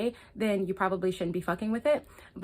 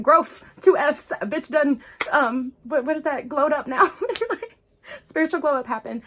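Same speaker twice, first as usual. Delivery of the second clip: playful excitement; 5 semitones higher, 5 dB louder than usual. Real, not acted. Joking to viewers about her growth, loud and high. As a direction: show off the glow up.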